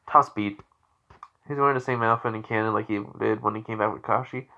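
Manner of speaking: plain reading voice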